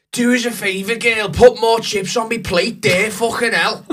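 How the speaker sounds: high, loud, slow rough Scouse fella; mock a loud Scouser demanding chips; rough Scouse impression. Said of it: "I'll give you an impression".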